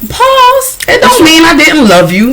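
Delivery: high-pitched, exaggerated comeback, stretching out the first Pause; sassy retort. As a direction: brush them off with a high, sassy tone. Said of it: "and I was like".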